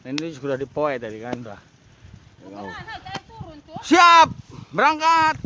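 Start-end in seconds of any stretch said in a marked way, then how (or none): 3.8-5.4 high, loud call to set off; rallying shout. Real, not acted. Vlogger ready to leave for work, rallying shout. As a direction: holler it out, pitched way up.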